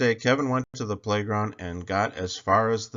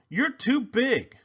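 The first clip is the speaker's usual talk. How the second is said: high, slow bully taunt; 4 dB louder, slower than usual. A narrator's reading. Bully Sammy taunting Kevin. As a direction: sneer it down at him.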